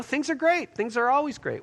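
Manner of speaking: high, sing-song insistence that all is great; mock upbeat spin. Minister spinning an answer about life with God, falsely upbeat. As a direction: put on a high, too-cheery front.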